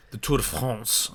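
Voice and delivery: put-on slow, showy French accent on "Tour de France"; mock French accent. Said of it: "he's like".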